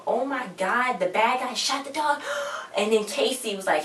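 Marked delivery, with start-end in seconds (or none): 0.1-2.2 high-pitched retold shock; hammed-up horror, voice raised high. Her shocked reaction to the shooting. 2.2-2.7 dramatic sharp gasp; audible intake of breath, aghast; shocked gasp. Retold or imitated. Gasp after the dog is shot.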